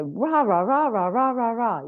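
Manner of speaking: high sing-song "rah rah" babble; animal jabber. Retold or imitated. Animals talking non-stop to the communicator, mock jabber. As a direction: rattle off nonsense syllables like a chattering animal. Imitating animals chattering away nonstop.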